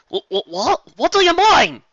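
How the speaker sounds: high, loud stuttered question; stammering shock. Acted. Applejack shocked by Apple Bloom, high stammer. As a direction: stumble over the words in shock. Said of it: "Applejack gasped".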